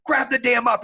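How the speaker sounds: high, loud, impatient command; petty officer's barked order. Retold or imitated. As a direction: yell it like an in-your-face drill instructor. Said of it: "he's in my face like a damn chihuahua".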